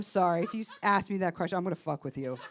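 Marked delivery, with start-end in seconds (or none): none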